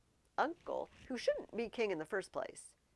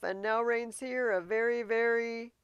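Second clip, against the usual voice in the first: loud, higher, teasing recital; Hamlet reciting verse; 5 semitones higher, 12 dB louder than usual. Acted. Hamlet recites his rhyme to Horatio, loud and teasing. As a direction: hold back the last word with a smirk.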